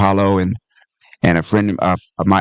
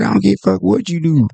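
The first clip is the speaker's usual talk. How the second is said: gruff club manager; 9 semitones higher than usual. Retold or imitated. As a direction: toss it off like you couldn't care less. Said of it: "He said".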